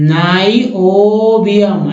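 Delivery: नाइओबियम stretched slowly, "nai-o-bium"; correct reading. Shown as a demonstration. Teacher repeating the right way for learners, correct reading. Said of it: "ऐसे पढ़ेंगे".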